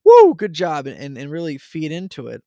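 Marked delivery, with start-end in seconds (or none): 0.0-0.9 high, loud "Woo, good job"; excited cheer. Shown as a demonstration. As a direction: cheer your kid on with real energy. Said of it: "be like".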